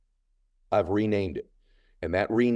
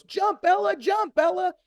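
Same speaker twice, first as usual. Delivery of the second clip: imitated yelling; slower than usual. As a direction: mimic people yelling from their boats. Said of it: "everyone's yelling on their boats".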